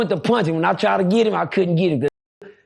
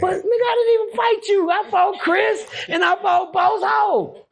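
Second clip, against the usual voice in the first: panicked pleading; 14 semitones higher than usual. Retold or imitated. Begging Mutt, who holds sharpened pencils, in a high crying voice. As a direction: whine that you never fought him.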